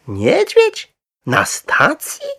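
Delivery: high, loud question in slow disbelief; astonished. A narrator's reading. Wife to husband about a bear at the station, astonished. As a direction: gasp out the question, pitch leaping up. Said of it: "ze zdumieniem".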